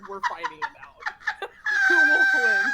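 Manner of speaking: high, laughing announcer-style call; hyping up a showdown; mock fight hype. Hyping the Friendsgiving fight to her friend, laughing.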